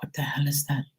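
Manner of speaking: startled whisper. Retold or imitated. Startled by spirit touch.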